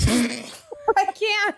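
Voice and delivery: ordinary delivery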